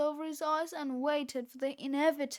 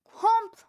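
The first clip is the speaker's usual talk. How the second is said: high, loud snapping chomp; loud chomp sound; 7 semitones higher, 10 dB louder than usual. A narrator's reading. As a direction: bark out the bite noise. Dog's bite sound read aloud.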